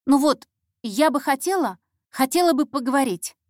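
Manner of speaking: start, falter and restart eagerly; hesitant intern. Acted. Intern asking the detective for a talk, nervously hesitant.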